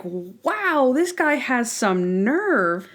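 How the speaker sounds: mock outrage at the father's gall; incredulous. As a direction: drag it out in sarcastic disbelief. Mocking viewer reaction to father.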